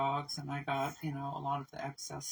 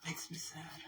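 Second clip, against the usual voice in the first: whispered sadness; 11 dB quieter than usual. Real, not acted. Repeats it under her breath.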